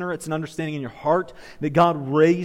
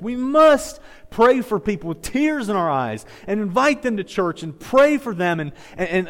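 raised, urgent pulpit exhortation; impassioned preaching; 6 semitones higher, 5 dB louder than usual. Real, not acted. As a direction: press the congregation with rising force. Preaches at full force.

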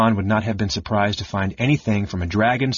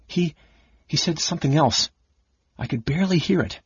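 higher, hesitant and shaken; 6 semitones higher than usual. Acted. Chrysania telling Caramon what she heard, hesitant and shaken. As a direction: falter through it, frightened. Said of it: "Then, hesitantly".

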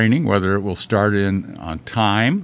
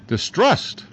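"Distrust" with a high rise, pronounced crisply; clearly enunciated; 8 semitones higher than usual. Shown as a demonstration. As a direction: say "Distrust" slowly and distinctly. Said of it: "I hope it's coming clear enough there".

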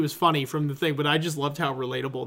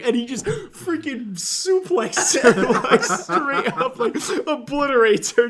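higher, slow, laughing build-up; giggly excited storytelling; excited laughing retelling; 8 semitones higher, 4 dB louder, slower than usual. Retold or imitated. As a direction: relish the punchline, laughing.

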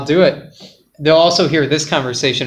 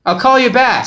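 raised pitch for the first speaker; higher first voice; 7 semitones higher than usual. Acted. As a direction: lift the voice for the first character. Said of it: "I'll try and change my voice".

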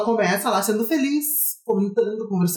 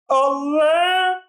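cracked falsetto; 5 semitones higher, 3 dB louder than usual. Real, not acted. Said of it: "com essa voz maravilhosa de Taquara Rachada".

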